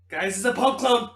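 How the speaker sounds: high, loud excited yell; excited shout. Real, not acted. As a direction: announce it to the table. Player shouts discovery.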